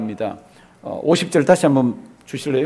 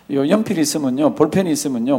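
ordinary talk

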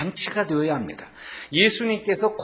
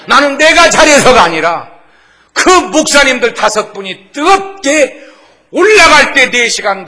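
목소리를 높여 힘주어 설교하듯; 회중을 향해 힘차게 외치듯 설교; 열정적인 설교; 7 semitones higher, 12 dB louder than usual. Acted. Preaching, voice rising. (설교자가 회중에게 힘주어 외치는 설교)

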